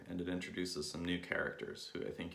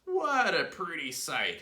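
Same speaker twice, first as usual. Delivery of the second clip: gloating husband; 10 semitones higher, 7 dB louder, slower than usual. Acted. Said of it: "he said to himself".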